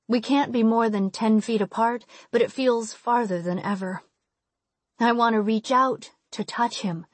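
ordinary delivery, nothing marked

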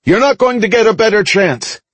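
high-pitched urgent call; urgent shout. A narrator's reading. Narrator urges Veronica over the comm, urgent shout. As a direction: press the order home, voice pitched high. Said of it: "I shouted over the comm".

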